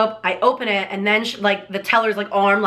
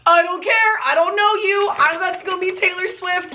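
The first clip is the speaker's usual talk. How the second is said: high, excited retort, reenacted; giddy defiance; 7 semitones higher than usual. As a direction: fire back at onlookers, high and elated. Retold defiant reply to adults.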